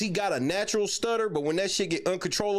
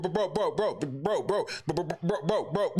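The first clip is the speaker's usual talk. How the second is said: ordinary talk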